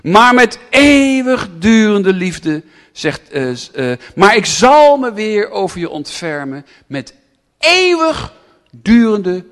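slow and loud on eeuwigdurende; a pastor proclaiming God's lasting love; emphatic preaching. Acted. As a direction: stretch out the promise of love.

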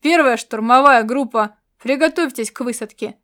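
loud barked command over the intercom; barked order. A narrator's reading. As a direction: bark the order sharply. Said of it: "он рявкнул по селектору".

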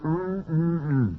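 humming refusal through closed lips; mouth clamped shut. Acted. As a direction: keep your lips sealed and whine no.

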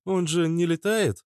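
high-pitched female character; high, put-on woman's voice. A narrator's reading. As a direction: lift the pitch well up for the woman's line. Narrator voices woman's question.

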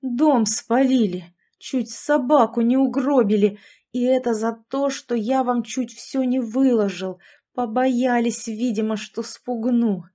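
put-on angry old man. A narrator's reading. Old man's furious complaint.

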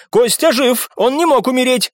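high-pitched desperate insistence; flaring protest; heated denial. A narrator's reading. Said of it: "вспылил Виконт".

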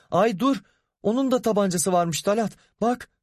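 frightened shriek. A narrator's reading. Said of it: "Müfide çığlığı bastı". (silahı görünce çığlık atan kadın)

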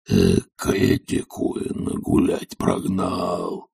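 husky drawn-out undertone; wheezing with a chuckle. A narrator's reading. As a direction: rasp it to yourself, amused. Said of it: "с усмешкой сипел он про себя".

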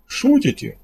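high-pitched question through a forced smile; nervously hoping it's a joke; uneasy attempted joking. A narrator's reading. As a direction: force a little smile while asking, pitch up. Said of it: "выдал из себя улыбку".

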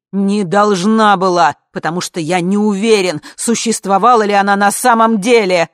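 loud, emphatic man's protest; forceful, full-voiced yell; shouting. A narrator's reading. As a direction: shout it over the din. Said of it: "кричал он жене".

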